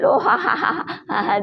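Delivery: mocking belly laugh; hearty laughing. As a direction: laugh out loud at your friend. Characters laugh at each other.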